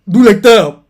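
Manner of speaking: loud, high-pitched indignant rant; exasperated. Real, not acted. Reader protests that he is fooling her, exasperated. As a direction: rant at full volume, annoyed. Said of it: "ça m'énerve".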